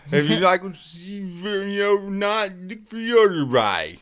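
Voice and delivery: mock the candidate's folksy plea; drawled Biden parody; Joe Biden impression. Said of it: "That's Joe Biden".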